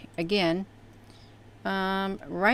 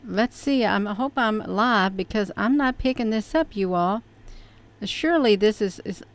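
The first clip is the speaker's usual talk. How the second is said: usual speaking voice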